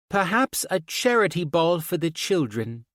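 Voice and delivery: put-on child putting on a posh accent; high girl's voice playing posh. A narrator's reading. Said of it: "in her most posh accent".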